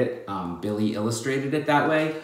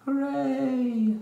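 cheer; 13 semitones higher than usual. Acted. Celebrating the ferret's return.

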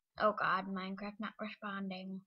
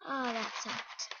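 ordinary talk

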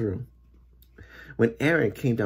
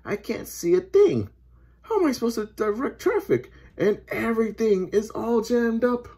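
high-pitched flustered complaint; exasperated policeman; 11 semitones higher than usual. A narrator's reading. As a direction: protest helplessly in a raised voice. Policeman cannot direct traffic.

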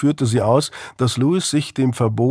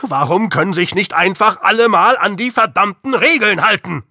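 louder, higher furious rant; angry outburst; 8 semitones higher, 3 dB louder than usual. Acted. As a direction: hammer on the word Regeln in anger. Heated father-son fight.

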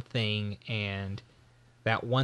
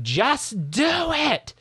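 high, drawn-out mock scream; screamed imitation; 13 semitones higher, much slower than usual. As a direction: imitate fans screaming, stretching out each word. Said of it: "Why were they screaming".

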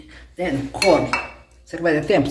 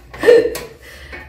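high giggle; 6 dB louder than usual. Real, not acted. Delight at dessert.

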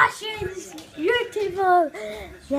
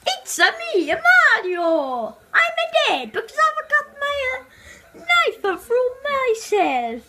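higher, fast, whiny Italian-ish Mario; 7 semitones higher than usual. Pretend. Kid plays Mario stuck with a knife, pitched-up whine. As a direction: play Mario as a hurt toy, pitched up.

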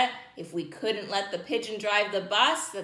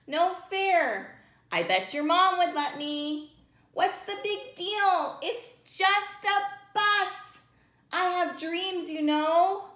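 whiny pigeon; 10 semitones higher, slower than usual. Acted. Pigeon whining.

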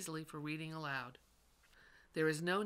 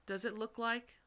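a raised, questioning teacher voice; teacher's questioning prompt; 6 semitones higher than usual. Shown as a demonstration. Teacher prompting a student to check a word, questioning. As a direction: ask it like a patient reading teacher. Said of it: "questions and prompts".

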